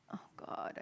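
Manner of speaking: unvoiced whisper of dismay. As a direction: breathe it out as a private groan. Exasperated inner thought.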